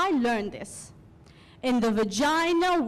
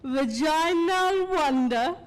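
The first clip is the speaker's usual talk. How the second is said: high, slow, drawn-out tone of wonder; stretched-out, reverent awe in a raised voice; workshop leader's phrase; 5 semitones higher, 4 dB louder, slower than usual. Retold or imitated. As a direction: intone it grandly, imitating the workshop leader. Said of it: "the woman who ran the workshop called".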